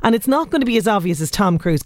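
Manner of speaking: ordinary delivery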